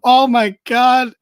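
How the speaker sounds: loud, slow, put-on shock; mock surprise. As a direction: act startled at being caught. Caught on mic, feigned surprise.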